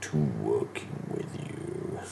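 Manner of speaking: low, soft and charming; deep devil voice. Acted. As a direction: soothe with menace. The archdevil voice continues.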